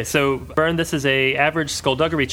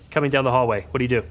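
usual speaking voice

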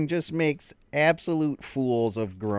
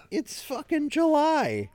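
mom fed up; 12 semitones higher, slower than usual. Retold or imitated. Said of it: "And your mom's like".

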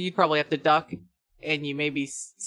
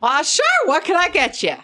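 put-on high, loud accented server; bad-accent barkeep; 11 semitones higher, 7 dB louder than usual. Barkeep asking what the customer will drink, hammed-up accent. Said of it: "okay with this accent".

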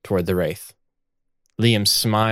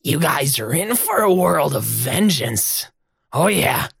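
higher, taunting threat; gloating ghost; 7 semitones higher than usual. Acted. Ghost Liam threatens the group with gleeful menace. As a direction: taunt them like a smug ghost. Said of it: "and Liam says".

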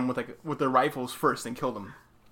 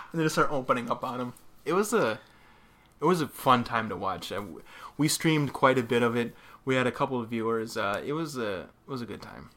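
ordinary delivery

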